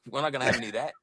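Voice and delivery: fast laughing Aussie line; quick mock Australian accent. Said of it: "That's your Australian accent?".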